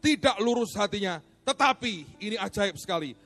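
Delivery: loud, high, forceful sermon delivery; fiery preaching. Acted. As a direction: preach it with full force to the congregation. Preacher at full force opening.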